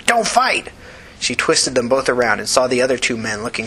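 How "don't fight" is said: high-pitched screeched command. A narrator's reading. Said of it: "she screeched at him".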